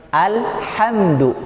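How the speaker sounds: high, drawn-out "Alhamdu" with clean mid-throat ḥ. Shown as a demonstration. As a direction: recite "Alhamdu" slowly, crisp L without bounce. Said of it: "Oke, bersih ya".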